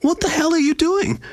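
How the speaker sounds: high-pitched, incredulous demand; shocked. A narrator's reading. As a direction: blurt it out in shocked disbelief. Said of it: "Cyan asked with shock".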